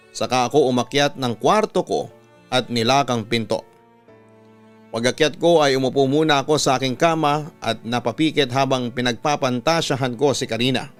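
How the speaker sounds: ordinary talk, nothing marked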